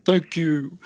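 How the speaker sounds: rough impression that slips toward a cool greaser. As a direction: wobbly attempt at the King. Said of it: "It's more like the Fonz".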